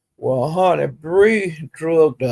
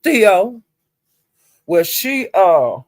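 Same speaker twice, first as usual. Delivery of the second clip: ordinary talk, nothing marked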